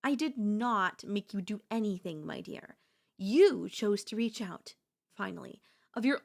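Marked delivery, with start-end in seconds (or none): 2.3-2.8 whispered my dear, voiceless. Acted. Girlfriend hushing her date's protest, whispered my dear. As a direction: drop to a secret hush on my dear. Denying she forced him.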